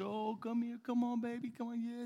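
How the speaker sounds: put-on goofy grandparent cooing come on baby; goofy parent. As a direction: coo at a baby taking first steps. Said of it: "a lot of goofy parents".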